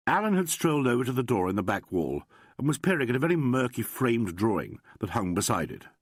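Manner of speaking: ordinary delivery, nothing marked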